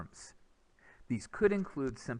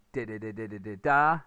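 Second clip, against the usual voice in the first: louder di beats rising onto a final stressed da; rhythm syllables; 4 semitones lower, 4 dB louder than usual. Shown as a demonstration. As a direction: chant the question's beat, lift at the end. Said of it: "it's gonna sound like".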